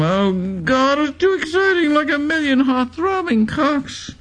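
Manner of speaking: high, swooning falsetto gasped out in ecstasy; whimpering ecstatic queen. A narrator's reading. Queen collapsing in ecstasy at the riot, high swooning falsetto. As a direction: squeal it like a queen overcome with pleasure. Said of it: "A whimpering queen falls to the floor in an orgasm".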